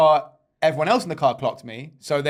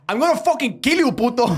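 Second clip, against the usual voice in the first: James Bond; 11 semitones higher, 6 dB louder than usual. Acted.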